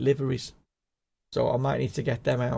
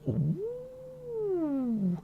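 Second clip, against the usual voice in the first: high ooh; 7 dB quieter than usual. Real, not acted. Reacts to the new boiler.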